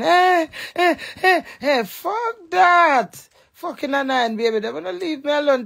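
high, loud cursing outburst; shouted tirade, hammed up; mock angry yell. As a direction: bark it out like you're fed up and shooing someone off. Acted outburst at the baby.

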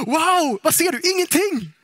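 gasp, then high, loud excitement; hammed-up loud wonder; mock amazement. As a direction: play an over-thrilled sightseer, pitched right up. Acting out visitor at empty sight.

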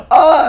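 high, gasping yelp; sudden high "ah" after a gasp; startled yelp. Real, not acted. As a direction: cry out in alarm. Startled cry in technical trouble.

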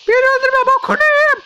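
very high, loud falsetto plea; mock squeaky begging. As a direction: squeal for mercy, hammed-up. Begging a game enemy for mercy.

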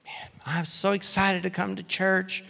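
hushed, lightly gushing mimicry; mock excitement. Imitating an excited new churchgoer, mock enthusiasm. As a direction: put on a small, thin thrill.

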